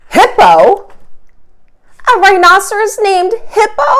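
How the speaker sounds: high, loud incredulous question; high surprised delighted question; amazed porcupine. Acted. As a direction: ask it in delighted disbelief. Fluffy hears funny name.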